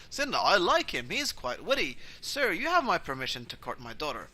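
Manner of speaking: loud, swooping fatherly approval; high, hearty, amused father; delighted vampire dad. Acted. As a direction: grandly give your blessing. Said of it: "Voltar goes".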